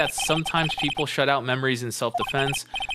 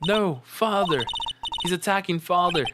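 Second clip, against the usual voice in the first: put-on high panicked child; 5 semitones higher than usual. Voicing panicked child Edgeworth.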